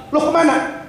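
sudden high startled question; a mock-startled sheep squeaking up; surprised sheep. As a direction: act out the sheep's alarm.

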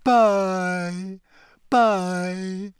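high, long-drawn mournful farewell; doleful ghostly goodbye; gloomy. A narrator's reading. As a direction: stretch each word into a sad moan. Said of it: "she said gloomily".

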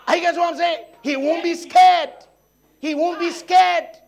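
high, loud pulpit shouting; full-force shouted sermon climax; preaching shout. Real, not acted. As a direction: roar it out as the climax.

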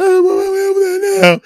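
put-on shrill it out like an angry girlfriend; upset girlfriend. Girlfriend calling the house, hammed-up upset. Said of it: "she was upset".

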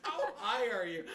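repeating the line, laughing; a light, amused echo of the line; mock incredulous. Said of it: "You're like".

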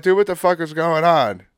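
mock yell of confusion at the officer; retold exasperated yell. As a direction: blurt it out, fed up and baffled. Retold outburst at the cop.

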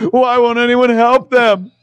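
high, loud, slow plea; pleading wail. Retold or imitated. Girlfriend on the phone with John, pleading high. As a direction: beg like it's real. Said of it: "she goes".

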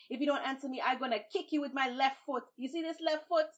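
loud, high threat; kicking threat. Acted. Babuki threatens to kick the tar baby, loud. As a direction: warn her about the foot.

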